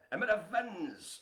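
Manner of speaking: put-on Welsh accent. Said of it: "say Emma Evans in a Welsh accent".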